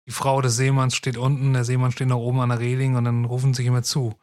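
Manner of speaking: ordinary talk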